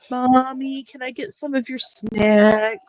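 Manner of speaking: put-on high pleading child voice; kid voice. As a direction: beg like a hungry kid. Mother voices hungry son begging.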